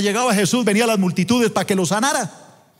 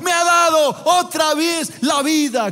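a healed leper shouting his thanks to God; joyful shout; 8 semitones higher than usual. Acted. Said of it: "gritaba este hombre".

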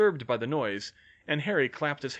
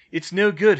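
loud, raised report; higher, louder call across a distance; 7 semitones higher, 11 dB louder than usual. A narrator's reading. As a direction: project it to someone far off. Bill calls his report to Hermione.

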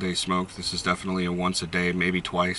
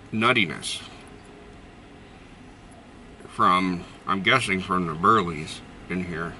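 talking around pipe stem; pipe in mouth; slower than usual. Real, not acted. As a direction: mouth corner clenched. Seen 0: Pipe clenched in mouth corner throughout; R frames show pipe held away.

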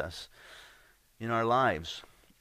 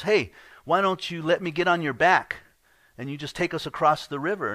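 lightly voiced scorpion, higher and louder; coaxing scorpion; 6 semitones higher, 6 dB louder than usual. Scorpion asks frog for a ride across, coaxing. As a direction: pitch it up, a storyteller's wheedling scorpion.

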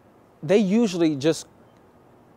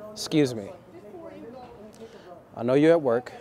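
slow, soft and unhurried opener; calm, slow greeting to a working woman; slow approach; 3 dB quieter than usual. Retold or imitated. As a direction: take your time, gently. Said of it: "and I'm slow".

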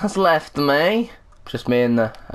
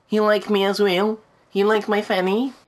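cooing; 8 semitones higher than usual. Pretend. Talking to toy duck.

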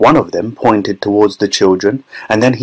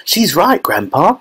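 put-on boy's cry starting high, then dropping; excited cry; 7 semitones higher than usual. A narrator's reading. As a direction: cry out high without much volume. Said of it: "cried Charlie".

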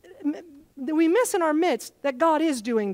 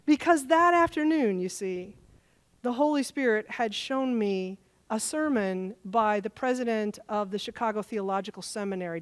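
normal talking voice

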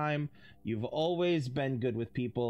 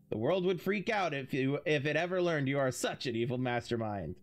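normal talking voice

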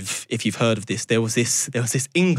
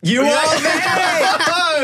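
high, drawn-out delighted shout; excited shout; much slower than usual. Real, not acted. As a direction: stretch it out in delight. Hosts cheering the goat reveal.

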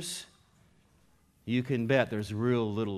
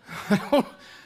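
real laugh; 9 semitones higher, 7 dB louder than usual. Real, not acted. Laughs at own retort.

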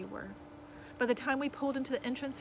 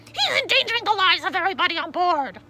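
high, very loud furious yell; exploding in outrage; 9 semitones higher, 13 dB louder than usual. A narrator's reading. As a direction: shout the accusation in alarm. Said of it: "the man exploded".

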